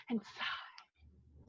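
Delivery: breathy whisper. Acted. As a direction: whisper the last words. Narrator whispers.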